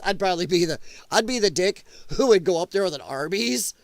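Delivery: ordinary delivery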